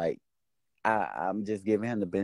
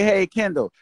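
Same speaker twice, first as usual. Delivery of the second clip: much higher, loud sing-song "Hey, Kendall"; mock greeting; 11 semitones higher, 5 dB louder than usual. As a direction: mimic a fake-cheerful hello, pitched way up. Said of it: "Ain't no fucking say".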